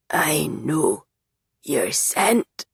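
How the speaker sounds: low and raspy. Acted. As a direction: breathe it out low and rough. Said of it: "His voice is low and raspy".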